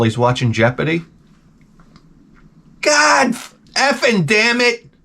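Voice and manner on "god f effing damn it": high, strained, drawn-out curse; comic blow-up, catching the swear midway; mock losing it. Pastor missing a Jeopardy question, high exasperated outburst.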